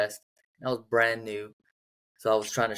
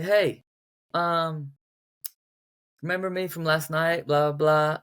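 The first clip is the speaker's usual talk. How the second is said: higher, lightly voiced flirty caller; light flirty woman; 5 semitones higher than usual.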